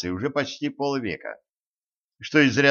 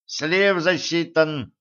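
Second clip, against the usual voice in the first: absent-minded; 6 semitones higher, much slower than usual. Acted. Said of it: "рассеянно отмахнулся Гремлин".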